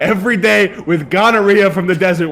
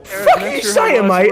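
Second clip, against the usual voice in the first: much higher, indignant snarl; outlaw's high challenge. Acted. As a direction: challenge him like a scrappy thug. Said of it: "Ripple McKinley".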